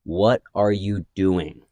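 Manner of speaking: very slow and deliberate, each word weighed; slow staring question. Retold or imitated. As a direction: lock eyes and drag it out. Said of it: "I looked him right in the eyes".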